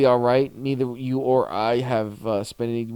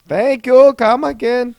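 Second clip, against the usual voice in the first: high, loud fake Indian accent; 10 semitones higher, 9 dB louder, slower than usual. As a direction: put on a shopkeeper's Indian accent. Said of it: "that fake Indian accent".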